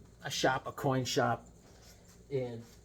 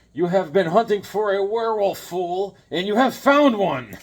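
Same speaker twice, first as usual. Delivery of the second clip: higher, louder menacing monster voice; werewolf taunt; 6 semitones higher, 7 dB louder than usual. Retold or imitated. Werewolf mocks the hunter in comic, hammed-up monster voice. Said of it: "He's like".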